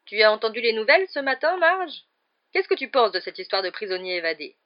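loud, lifted question; abrupt topic change. A narrator's reading. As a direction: jump in brightly to change the subject. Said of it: "changea brusquement de conversation".

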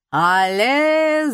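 high, loud showman's call; loud yell. A narrator's reading. Magician shouting his cue in the ring, loud yell. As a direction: yell it out to the arena. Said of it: "заорал Жиль".

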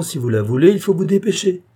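eager uncle pressing the deal; urgent. Acted. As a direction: hurry them along. Uncle urging them to hurry.

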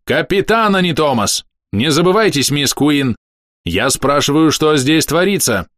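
high-pitched, stern dressing-down; barked reprimand. A narrator's reading. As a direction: pull rank and demand answers. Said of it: "рявкнул я".

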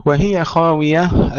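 وهي خاوية recited high and slow; high slow recite. Shown as a demonstration. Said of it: "ننتبه إلى كلمة وهي".

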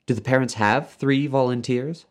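high-pitched woman character's question; Leora, inquiring. A narrator's reading. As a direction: raise the voice high for the receptionist. Voices Leora Duncan.

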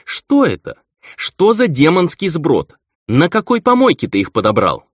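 loud, high-pitched indignant outburst; scandalised and loudly scornful; appalled scolding. A narrator's reading. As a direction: demand an explanation in outrage. Appalled angel berates her friend.

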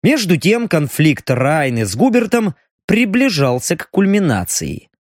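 ordinary delivery

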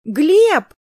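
put-on loud, high child's yell; high, drawn-out calling yell; full-force shout. A narrator's reading. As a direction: shout the name with all your might. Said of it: "закричала Крис, что есть мочи".

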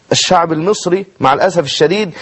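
usual speaking voice

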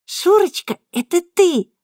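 put-on very high, bright child's voice; grinning little girl's discovery; smiling. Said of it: "улыбнувшись".

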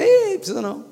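high, scoffing brush-off; mocking dismissal. Preacher scoffing at needing a candidate, mocking. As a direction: wave it away with a scoff.

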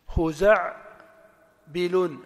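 خزع loudly as khuza', pause, بلون as bilun; different sound pattern. Shown as a demonstration. Teacher tests another rhythm, split by syllable. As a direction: break it after خزع. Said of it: "ليست نفس التنغيم".

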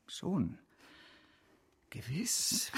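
a barely voiced, grudging gewiss; unvoiced whisper. Banker concedes to his partner, whispering. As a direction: breathe the word out with no voice. Reluctant muttered agreement.

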